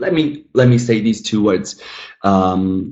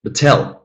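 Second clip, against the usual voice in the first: "Batel" with the weight on TEL; wrong stress on second syllable; 2 semitones higher than usual. Shown as a demonstration. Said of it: "let's switch the stress".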